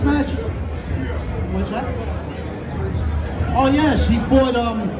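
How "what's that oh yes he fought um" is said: usual speaking voice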